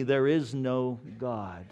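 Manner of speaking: normal talking voice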